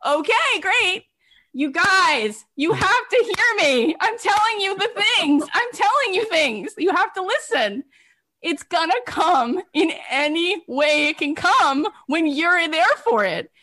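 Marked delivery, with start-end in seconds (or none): 0.1-1.0 high-pitched retold delight; eager acceptance. Retold eager acceptance of free stay. 1.5-11.3 high, emphatic plea to listeners; urgent exhortation. Real, not acted. Urgently pleading with audience to listen.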